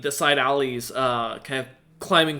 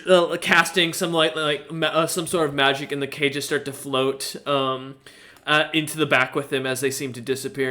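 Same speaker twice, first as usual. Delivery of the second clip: usual speaking voice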